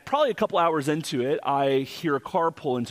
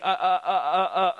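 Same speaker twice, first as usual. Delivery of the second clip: hammed-up, higher-pitched stuck stammer; tongue-tied stammer; 5 semitones higher than usual. Teenager at gunpoint forgetting his own name, mock tongue-tied stammer. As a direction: imitate stuttering, unable to get a word out. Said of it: "don't even remember my name".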